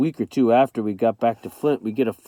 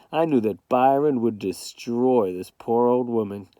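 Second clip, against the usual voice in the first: ordinary delivery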